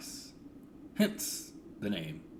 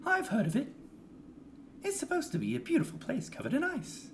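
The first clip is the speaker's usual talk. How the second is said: high character voice. Acted.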